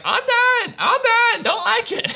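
very high, loud mock toddler wail; upset toddler. Dad imitating his toddler quitting the tricycle, high-pitched tantrum. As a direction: squeal in protest like a small kid giving up. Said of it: "He's like".

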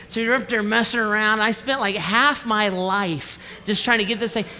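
usual speaking voice

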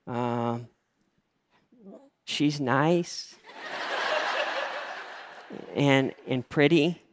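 soft, halting answer with long empty pauses; unprepared, lost. Retold or imitated. Boyfriend answering why he loves the daughter, stumped. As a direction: grope for words and come up feebly short. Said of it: "I was not prepped at all".

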